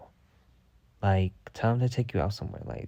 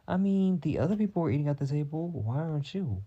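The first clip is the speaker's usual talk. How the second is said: sassy scolding; 6 semitones higher than usual. Retold or imitated. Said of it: "I'm like".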